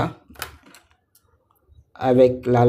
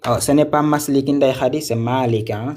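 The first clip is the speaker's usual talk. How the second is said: usual speaking voice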